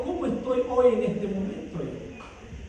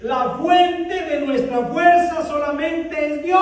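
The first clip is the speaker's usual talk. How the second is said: slow, louder shouted declaration; preaching shout; 4 semitones higher, 5 dB louder, slower than usual. Acted. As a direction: declare it slowly with full force. Shouted sermon point. (proclamación gritada y lenta)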